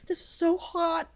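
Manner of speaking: high, drawn-out put-on voice; mock arousal. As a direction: imitate her pleasure in a high, slow voice. Voicing the aroused woman's line.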